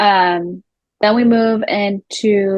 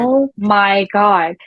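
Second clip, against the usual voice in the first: slow disbelief; much slower than usual. Retold or imitated. Host's own reaction, quoted.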